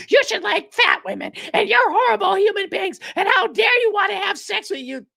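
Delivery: high mock scolding; mocking high shout. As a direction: screech it like a scold. Mocking women who shame men.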